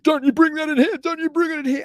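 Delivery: very high, loud panicked order; scared big man begging; imitating terrified pleading; frightened warning. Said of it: "He's like".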